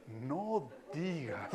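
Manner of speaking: muy lento y bajito, pasmado; atónito. Retold or imitated. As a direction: responde alargando las palabras, en voz baja. Marido responde atónito, cauteloso. (asombro contenido, imitado)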